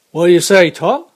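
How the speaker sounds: loud shouted question, rising at the end; guard hollering up to the driver; calling back. Acted. Guard calls back to coachman.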